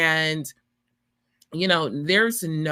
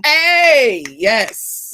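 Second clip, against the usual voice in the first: excited; 5 semitones higher, 9 dB louder than usual. Real, not acted. Cheering a prayer for building.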